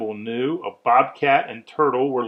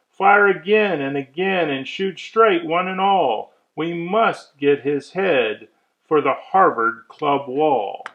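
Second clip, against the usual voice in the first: a loud, higher commanding hunter; hunter giving orders; 4 semitones higher, 4 dB louder than usual. Acted. Hunter commands his men to fire, loud and higher. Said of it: "heard a voice call".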